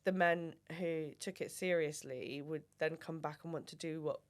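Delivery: ordinary talk, nothing marked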